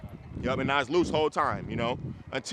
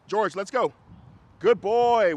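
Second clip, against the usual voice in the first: much higher and louder, bright praise; dog praise; 7 semitones higher, 9 dB louder, slower than usual. Real, not acted. Trainer praising the dog for walking right, high excited praise. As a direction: reward the dog with your voice. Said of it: "Now I'm gonna say".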